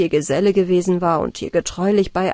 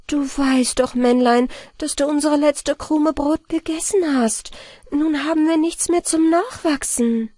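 exclaiming; 7 semitones higher than usual. A narrator's reading. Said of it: "Anna Barbara aber rief".

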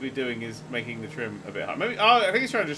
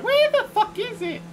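much higher, slowed, strained squeak of a voice; mock frantic search; 7 dB louder, slower than usual. Retelling his own hunt for the button, squeaky mock panic. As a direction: play yourself as a panicked little searcher. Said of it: "I'm following around the back going".

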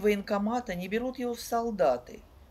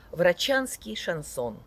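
plain reading voice

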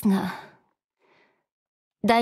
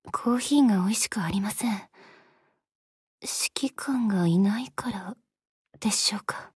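whisper; 2 semitones lower, 5 dB quieter than usual. Acted. Attendant whispers to herself that the coffee is worse without the commander. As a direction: let it slip out as a private whisper.

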